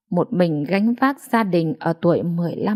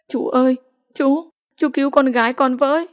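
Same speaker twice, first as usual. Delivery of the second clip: high, pleading wail, voice changed by tears; crying out; 5 semitones higher than usual. Acted. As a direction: beg the uncle through sobs to save her daughter. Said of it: "Loan cầm ống tay áo chú Năm khóc lớn".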